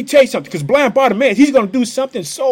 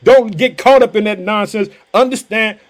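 ordinary talk

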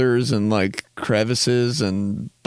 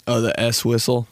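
normal talking voice

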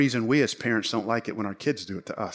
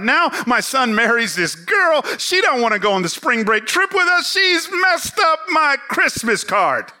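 a hint of a ticked-off older woman, pitched higher; mildly imitating an upset churchgoer; peeved older lady; 9 semitones higher, 6 dB louder than usual. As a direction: lightly voice her grumbling complaint. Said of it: "she's ticked off, and she's peeved".

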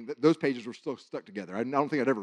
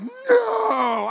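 excited shout; 10 dB louder than usual. Retold or imitated. Same message confirmed, speaker overwhelmed.

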